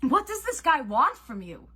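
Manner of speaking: high, loud, bewildered demand; urgent shouted question. A narrator's reading. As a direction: call it across to a friend. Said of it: "he called".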